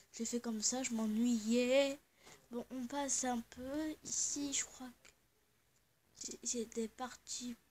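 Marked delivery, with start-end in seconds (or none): 4.1-7.6 whisper. Real, not acted. Whispering while exploring.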